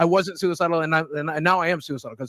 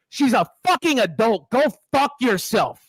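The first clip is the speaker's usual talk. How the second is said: angry rant; 7 semitones higher, 3 dB louder than usual. Real, not acted. Boogie raging at the critic about his girlfriend. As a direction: hammer each curse home.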